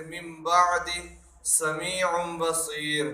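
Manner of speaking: slow recitation of سمیع بصیر, the tanween hummed as m before ب; iqlab. Shown as a demonstration. Teacher shows changing noon into meem, slow iqlab. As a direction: turn the n of من بعد into a held m before ب. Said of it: "میم سے بدل کر پڑھیں گے".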